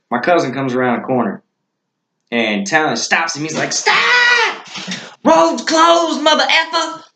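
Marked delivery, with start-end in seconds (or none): 3.7-7.0 very high-pitched mimicked holler; put-on high voice, hollering a halt; imitated traffic-stopping yell. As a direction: call out a high, comic road warning. Imitates Talon stopping the cousin.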